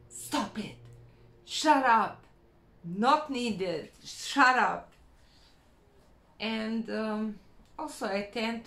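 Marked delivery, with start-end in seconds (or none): 0.1-4.9 a snippy cop shutting down the noise; bitchy police officer. Retold or imitated. Inner police officer silencing bad thoughts, loud and bossy. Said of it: "a kind of a bitchy police officer".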